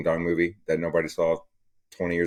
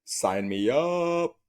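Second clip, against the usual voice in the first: mock excited shopper; 3 semitones higher, slower than usual. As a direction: drag it out like a thrilled shopper.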